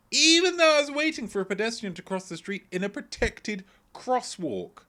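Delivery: high, loud indignant outburst; rising exasperation over a petty wrong; indignant rant. Real, not acted. Ranting about a driver who honked, indignant.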